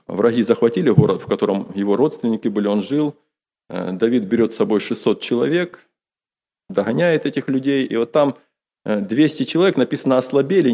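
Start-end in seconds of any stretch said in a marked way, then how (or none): none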